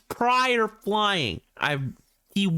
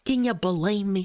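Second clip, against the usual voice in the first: ordinary talk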